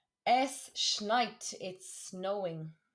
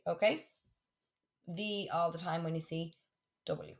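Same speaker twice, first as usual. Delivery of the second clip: usual speaking voice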